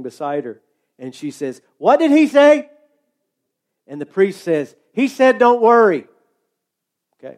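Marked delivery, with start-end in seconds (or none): 1.8-2.7 put-on high, loud, shouted question from a deaf old lady; hard of hearing lady. Deaf lady on falling plane. 4.9-6.1 loud, raised voice spelling it out; shouting to a deaf lady. Retold or imitated.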